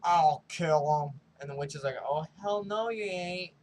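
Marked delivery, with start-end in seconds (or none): 0.0-1.2 caveman threat. Retold or imitated. Caveman Kyle volunteers to kill. 2.0-3.5 sassy refusal. Retold or imitated. Witch refuses Kyle, sassy.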